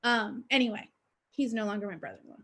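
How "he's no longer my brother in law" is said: low, soft, very quick throwaway; wry aside. Real, not acted. Storyteller ending her tale about the ex brother-in-law, wry aside. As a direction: toss off the closing punchline under your breath.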